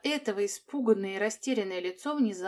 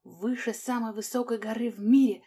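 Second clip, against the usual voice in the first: ordinary delivery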